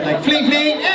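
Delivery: imitating a broken moped engine, loud; mock motor revving. Comic revving for the audience. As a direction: rev it like a sputtering moped. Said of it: "So it sounded like a Harley".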